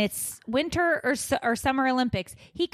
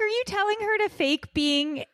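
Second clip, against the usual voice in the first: incredulous question; 10 semitones higher than usual. Retold or imitated. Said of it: "I was like".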